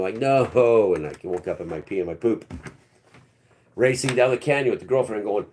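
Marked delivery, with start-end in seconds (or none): 0.2-0.9 a sharp, louder no; loud refusal. Retold or imitated. Man refusing to return to his body, loud no. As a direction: push back hard with one word.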